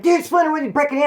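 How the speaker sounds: startled friend. Retold or imitated. Friends at door quoted.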